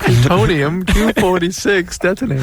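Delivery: cartoon alien voice.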